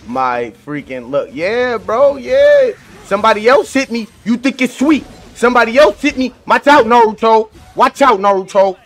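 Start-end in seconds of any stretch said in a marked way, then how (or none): 1.3-8.8 high, loud gamer taunting; shouting taunts at an opponent during a match; hyped trash talk. Real, not acted. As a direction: yell it at the screen, all fired up. Hyped trash talk mid-fight.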